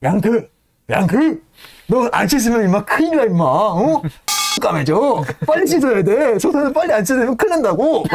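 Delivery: high, loud mocking shout at a mate; rowdy coworker ribbing a friend; teasing stoker. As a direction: jeer at him like a rough coworker. Stokers teasing Yank in retelling.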